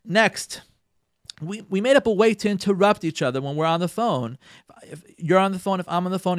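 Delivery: ordinary talk, nothing marked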